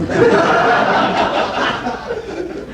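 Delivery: laughing high, no voice; a quick airy burst of laughter; high breathy laugh. Real, not acted. Telling the audience a taxi story, high breathy laugh.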